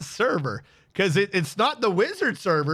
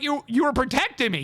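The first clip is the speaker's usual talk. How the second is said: high, indignant mock yell; imitated outraged protest; retold yelling; 6 semitones higher, faster than usual. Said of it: "I was yelling".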